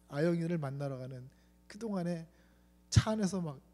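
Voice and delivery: normal talking voice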